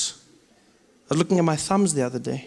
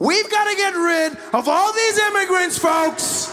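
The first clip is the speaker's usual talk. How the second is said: much higher shouted Trump rally voice; Trump shouting speech; 13 semitones higher than usual. Acted.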